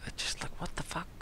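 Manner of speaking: a breathless whispered what the fuck; whispered disbelief. Real, not acted. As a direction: whisper it under your breath, baffled. Exasperated aside about the booking.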